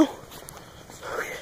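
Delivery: soft whispered okay; whispering. Real, not acted. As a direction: breathe it out unvoiced. Whispered cue while filming.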